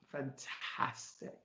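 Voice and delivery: slow, hushed whisper; awed whisper. Retold or imitated. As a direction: whisper it slowly, savouring the good news. His moved reply to her news.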